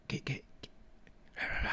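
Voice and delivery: whispered mumble imitating a shy borrower; mimicking a sheepish whispered request for money; mock whisper. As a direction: breathe it out, barely audible. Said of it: "they're, like, whispering".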